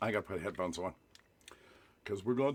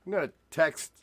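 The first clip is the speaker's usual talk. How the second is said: normal talking voice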